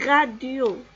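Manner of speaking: "radio" loud, as ra-dio; répétition modèle. Shown as a demonstration. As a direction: model "radio" for repeating. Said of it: "Alors on répète". (« radio » articulé fort, en ra-dio)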